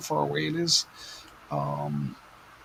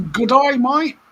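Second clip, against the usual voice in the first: mock Australian accent; 9 semitones higher, 9 dB louder, slower than usual. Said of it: "that's all the Australian I know".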